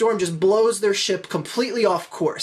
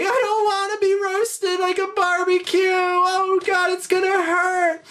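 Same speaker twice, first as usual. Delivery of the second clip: freaked-out crying; 10 semitones higher than usual. Acted. Fred dreads being roasted like a barbecue, hammed-up terrified crying. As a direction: wail it like a little scared baby. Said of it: "starts freaking out and crying like a little".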